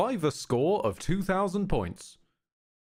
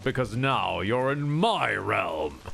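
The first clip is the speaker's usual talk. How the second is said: villain voice; 5 semitones lower, slower than usual. Retold or imitated. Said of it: "having this whole point about".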